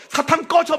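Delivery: loud, high yell at Satan; shouted prayer. Retold or imitated. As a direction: bark the prayer out in the dark. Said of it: "막 이렇게 기도를 하는 거죠". (사탄한테 높고 크게 외치는 기도)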